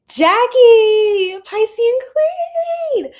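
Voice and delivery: high, loud, drawn-out hail; excited calling out. Real, not acted. Streamer hailing a viewer who just joined, excited calling out. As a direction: sing out a big welcome, stretching the last word.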